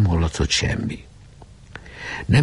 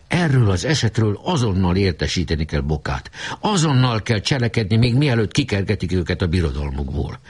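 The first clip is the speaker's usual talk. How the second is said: ordinary delivery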